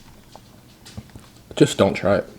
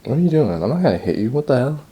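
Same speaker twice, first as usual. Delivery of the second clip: dog voice; 6 semitones higher, faster than usual. Retold or imitated. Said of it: "She's like".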